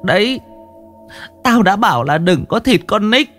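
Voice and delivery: put-on high, tearful old woman's lament; old woman whimpering. Old woman begging the master and scolding her children, high tearful. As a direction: wail and scold through tears. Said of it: "Bà cụ Tứ mếu máo".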